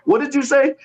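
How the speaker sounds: panicked, straining to hear the judge; frantic confusion. Retold or imitated. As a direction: deaf guy in phone booth begging the judge to repeat. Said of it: "in the phone booth like".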